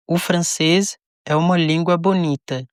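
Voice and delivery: ordinary talk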